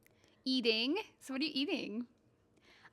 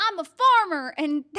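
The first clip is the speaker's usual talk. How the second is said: loud hammed-up farmer voice; 4 semitones higher, 13 dB louder, slower than usual. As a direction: play a folksy farmer, louder. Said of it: "assume you talk like that".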